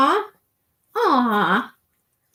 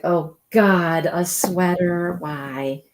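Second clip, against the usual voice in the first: lower, slow, mock-weary dog grumble; dog's complaining voice; 6 semitones lower, much slower than usual. Owner voicing dog forced into sweater, lower mock complaint. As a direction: groan it out as the put-upon dog. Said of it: "She's like".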